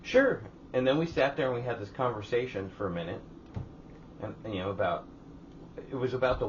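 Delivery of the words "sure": high, loud, perky retold reply; pleasant agreement. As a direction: mimic her eager yes. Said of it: "she's all pleasant and shit".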